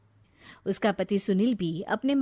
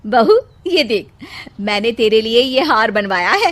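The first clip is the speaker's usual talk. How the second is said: proud mother-in-law, louder; 8 dB louder than usual. Acted. Mother-in-law shows bride a necklace, louder proud voice. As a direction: show off the gift to the bride.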